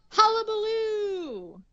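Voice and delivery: high, drawn-out call down into a pit; mock yell. Little girl calling down into the cave, mock yell. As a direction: stretch the word out like calling into an echoing cave. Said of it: "looks down into the cave and yells".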